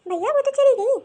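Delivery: a nervous little sister breaking the news; young sister. Acted. Sister tells brother his wife left with her family.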